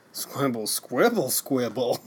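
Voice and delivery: playful nonsense-language chatter; mock foreign language. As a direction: imitate made-up foreign gibberish with a little lilt. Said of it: "can I do my impression of what they".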